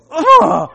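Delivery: high, loud groan of disgust; loud cringe groan. Retold or imitated. Recalling the most embarrassing moment, a loud cringing ugh. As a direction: groan like the memory stings.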